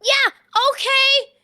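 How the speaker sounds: high, loud yell; high-pitched frantic agreement; eager shouted reply. Acted. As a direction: shout back excitedly, high. Puppet answers urgent call.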